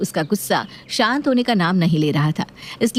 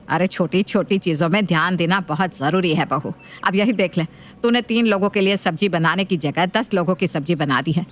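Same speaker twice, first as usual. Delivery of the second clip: nagging mother-in-law voice. Acted.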